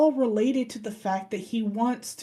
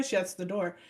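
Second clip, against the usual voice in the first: normal talking voice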